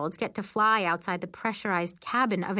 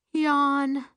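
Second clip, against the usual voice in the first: bored; 8 semitones higher, 5 dB louder than usual. Acted. Bored narrator mocks the hovering.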